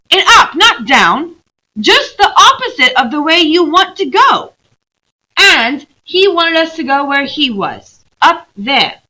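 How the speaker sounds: high, loud, urgent pointing; little girl showing the way, insistent; eager young girl. Acted. Lucy pointing out where Aslan stood, high and urgent.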